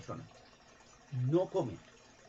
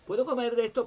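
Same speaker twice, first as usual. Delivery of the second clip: high, louder mocking plea; mock timid asking; 10 semitones higher, 6 dB louder than usual. Mocking a timid Eve asking God, high. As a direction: ham up asking permission.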